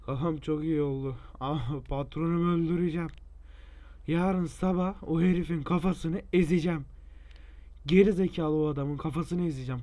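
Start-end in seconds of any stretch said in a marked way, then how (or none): 1.4-9.8 angry ranting. Acted. Ranting threats at boss.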